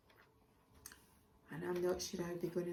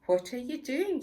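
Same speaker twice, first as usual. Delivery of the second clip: laughing; 7 semitones higher, 9 dB louder than usual. Acted. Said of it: "Jamie laughed".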